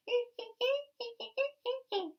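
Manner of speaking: high, squeaky monkey laugh; cheeky monkey cackle; monkey chatter. Acted. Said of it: "monkeys ... said".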